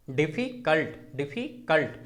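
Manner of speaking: "Difficult" split as डिफी-कल्ट; deliberate two-beat "difficult"; pause inside word. Shown as a demonstration. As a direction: repeat "difficult" with a small gap before cult. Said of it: "बीच में थोड़ा सा".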